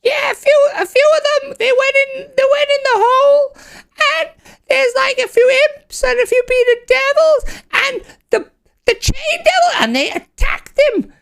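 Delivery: very high squeaky little imp. Acted. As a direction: chatter it out like a tiny nervous imp. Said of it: "one little imp goes".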